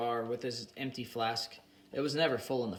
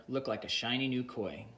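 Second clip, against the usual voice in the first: plain reading voice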